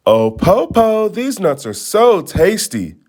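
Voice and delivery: put-on slow, high, tempting girl; teasing child. Children tempt the wolf from the tree, teasing.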